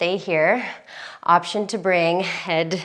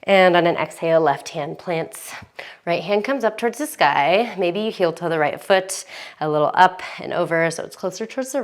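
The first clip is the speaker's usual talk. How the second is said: calm yoga instructor. Acted.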